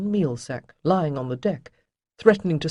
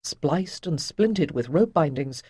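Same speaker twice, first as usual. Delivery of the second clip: usual speaking voice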